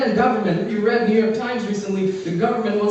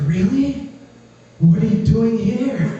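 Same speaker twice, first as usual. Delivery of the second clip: mock surprise; 3 semitones higher, slower than usual. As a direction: drag out the disbelief. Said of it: "She's like".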